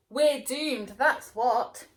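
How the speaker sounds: loud despairing cry; loud wail of doom. Acted. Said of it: "cried Cucumber".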